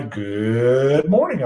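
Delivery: drawn-out "Good morning" in a put-on broadcast voice; slow announcer greeting. Meteorologist imitating his own recorded greeting, slow and drawn out. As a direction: stretch "Good morning" like a TV host. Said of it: "I would say".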